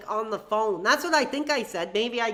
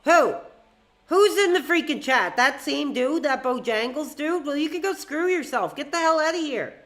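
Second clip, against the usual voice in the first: loud and higher, telling the troll off; angry yelling; 4 semitones higher, 3 dB louder than usual. Real, not acted. Streamer yelling at the troll in chat to get out, furious. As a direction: blast the troll straight out of the chat.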